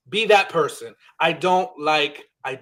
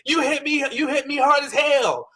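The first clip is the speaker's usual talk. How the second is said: high, whiny mock complaint; pitched-up imitation of a hurt reality star; mocking Huda's outburst; 9 semitones higher than usual. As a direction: squeal it like she's been wronged. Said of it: "as soon as she was like".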